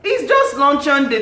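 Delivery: high, incredulous protest; loud, high-pitched disbelief; astonished onlooker. Acted. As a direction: react aghast at someone overdressed. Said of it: "and you're like".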